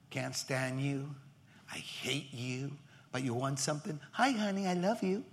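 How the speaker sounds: soft, low, lightly voiced; sulky, then sweet on "Hi, honey"; two-faced spouse. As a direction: imitate a spouse who only turns sweet when wanting something.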